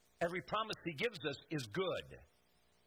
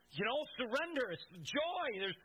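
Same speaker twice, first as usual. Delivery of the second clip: ordinary talk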